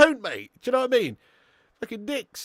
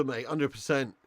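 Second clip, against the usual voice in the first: usual speaking voice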